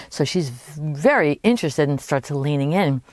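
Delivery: usual speaking voice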